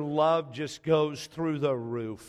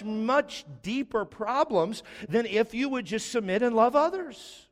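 higher-pitched, insistent sermon climax; impassioned preaching; 5 semitones higher, 3 dB louder, slower than usual. Real, not acted. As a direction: build the point, leaning hard on key words.

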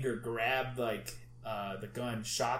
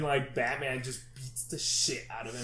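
normal talking voice

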